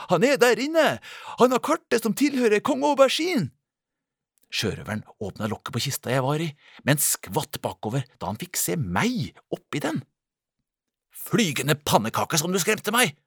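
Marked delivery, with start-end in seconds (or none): 0.1-3.5 high, loud call through a door; shouting. Acted. Guards outside the door shout to each other, loud. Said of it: "noen som ropte". 11.1-13.2 higher, louder startled outburst. Acted. Pirate finds a girl in the chest, startled cry. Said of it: "sa han forskrekket".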